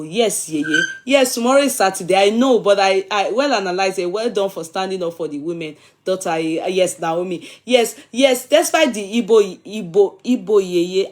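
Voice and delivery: usual speaking voice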